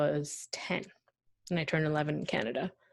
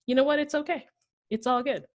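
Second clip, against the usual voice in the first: higher-pitched, easygoing self-reassurance; breezy acceptance; 7 semitones higher than usual. Retold or imitated. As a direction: act out shrugging it off lightly. Acts out calming herself, accepting it.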